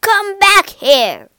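loud, high, drawn-out angry yell. A narrator's reading. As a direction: yell after someone running away. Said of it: "he yelled".

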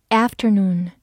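"afternoon" in an American accent, A as in cat; American pronunciation. Shown as a demonstration. Teacher gives learners the other pronunciation, American accent. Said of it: "美式发音afternoon".

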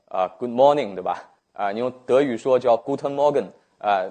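normal talking voice

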